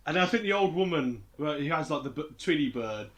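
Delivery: ordinary delivery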